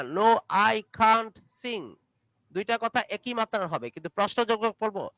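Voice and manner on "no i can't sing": "can't" stretched long, very slowly. Shown as a demonstration. Teacher drilling negative answers: slow drawn "can't". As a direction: draw out both answers evenly. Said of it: "উত্তর দিবেন".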